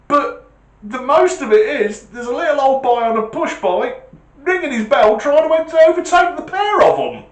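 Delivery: high, loud disbelieving report; amazed copper on radio. Retold or imitated. Copper radioing his sergeant in disbelief, high and loud. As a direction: tell Sarge something unbelievable. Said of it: "picks his radio up and goes".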